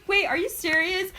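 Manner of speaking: imitating a panicked friend, high-pitched; retold worried question, pitched right up; mock scared. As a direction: play up the alarm while amused. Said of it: "She was so scared".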